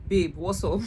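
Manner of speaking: loud, impatient message to a friend. Retold or imitated. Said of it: "I was getting very impatient".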